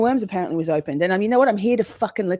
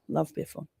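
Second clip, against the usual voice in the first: ordinary talk, nothing marked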